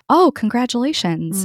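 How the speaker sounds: mock-bright receptionist voice; chirpy congratulations. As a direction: mimic the cheerful front-desk greeting. Receptionist greeting her at checkup.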